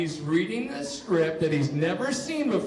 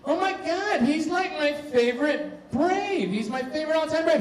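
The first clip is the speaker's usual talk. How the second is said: much higher, gushing fan squeal; starstruck fan; 10 semitones higher than usual. Retold or imitated. Fan gushing about favorite player.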